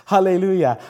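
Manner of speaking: ordinary talk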